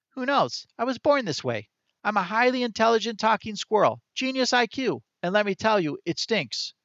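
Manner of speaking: high, quick squirrel voice pitched well up; chirpy little animal voice for the squirrel; talking squirrel. A narrator's reading. Narrator voicing the squirrel to Chester, high chirpy squirrel.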